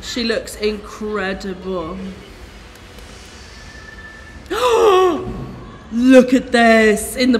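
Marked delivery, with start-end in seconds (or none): 2.0-5.9 big gasp of amazement; awed gasp. Real, not acted. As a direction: suck in air, stunned. Watching the rehearsal clip.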